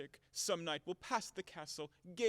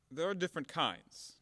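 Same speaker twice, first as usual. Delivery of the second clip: ordinary talk, nothing marked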